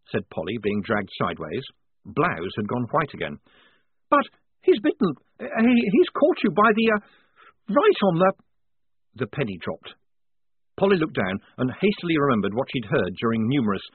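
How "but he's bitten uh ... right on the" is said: high, loud panicky stammer; flustered stammer. A narrator's reading. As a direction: stumble over every word in alarm.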